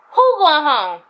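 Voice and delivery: retold indignant challenge; going off. Employee refusing to be sent home, retold indignation. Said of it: "so I just went off".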